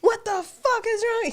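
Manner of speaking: high, incredulous yell at a stranger; drunk guy yelling. Retold or imitated. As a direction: shriek it like a furious drunk gambler. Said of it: "he was going in on this guy".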